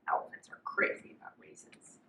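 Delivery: breathy whisper to the listener; hushed storybook secret; whispered aside. A narrator's reading. Narrator repeats elephant secret.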